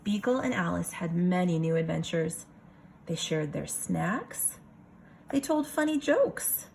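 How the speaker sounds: lively picture-book reading voice; story narration. A narrator's reading. Teacher reading a picture book to her class, storytelling voice. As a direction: read it for a room of kids.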